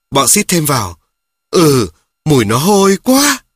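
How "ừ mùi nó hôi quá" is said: higher, louder sneering agreement; stinkbugs chiming in. A narrator's reading. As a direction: chime in with a sneer. Stinkbugs chime in mockingly. (bọn xít giọng cao, to, chê bai)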